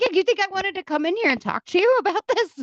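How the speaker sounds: high, loud sarcastic retort; exasperated yelling. Retold or imitated. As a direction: snap back in disbelief. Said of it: "I was like".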